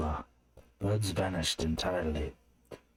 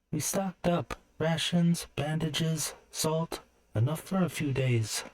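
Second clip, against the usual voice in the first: put-on much higher young woman's voice, listing supplies; Erin's voice; 10 semitones higher than usual. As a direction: chirp through the list like a cheerful girl. Erin lists supplies in story.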